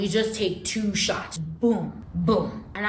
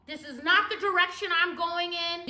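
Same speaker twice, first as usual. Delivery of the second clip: mildly panicked, higher-pitched protest; retold alarm, voice pitched up; freaking out; 6 semitones higher than usual. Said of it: "So now I start freaking out".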